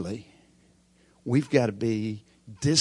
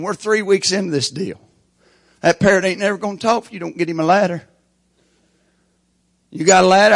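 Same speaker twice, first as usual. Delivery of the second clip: brisk, raised, punchy storytelling; lively joke telling; 6 semitones higher, 10 dB louder than usual. Acted. Preacher warming up the congregation with a lively joke. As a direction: sell the parrot joke with energy and higher pitch.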